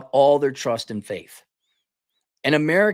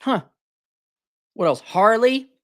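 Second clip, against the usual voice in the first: ordinary talk, nothing marked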